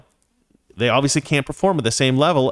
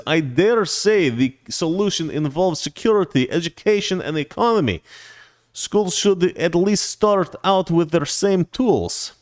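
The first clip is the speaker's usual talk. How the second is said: mock Russian accent, higher and slow; mock foreign accent; 5 semitones higher, slower than usual. Host reads viewer's super chat aloud, hammed-up mock Russian accent. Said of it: "let me read that in English".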